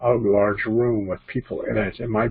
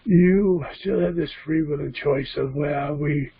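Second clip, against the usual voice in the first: put-on higher-pitched channeled spirit with a British-tinged accent; put-on Scottish-English accent, higher and deliberate; Lucerne; 4 semitones higher than usual. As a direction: speak as an otherworldly teacher, pitched up and measured. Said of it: "My voice will change. You'll notice it will change".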